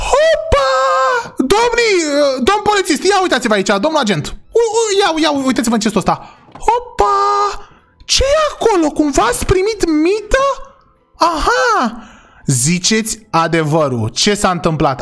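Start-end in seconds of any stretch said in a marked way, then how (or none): 0.1-12.0 much higher, eager voice; high gotcha excitement; excited discovery. Acted. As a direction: sound like you just caught them. Agent spots evidence, excited.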